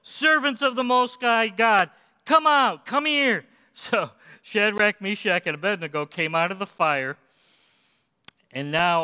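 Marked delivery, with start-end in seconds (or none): 0.0-3.4 calling out high and loud into the furnace; hollering king. Acted. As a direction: shout the names across the fire. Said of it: "he hollered".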